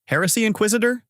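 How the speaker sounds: puzzled high-pitched echo; confused. A narrator's reading. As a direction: repeat it as a baffled question.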